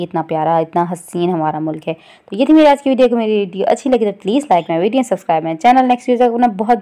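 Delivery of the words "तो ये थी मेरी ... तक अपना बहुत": bright, loud sign-off; vlog outro. Acted. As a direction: wrap up cheerfully for viewers.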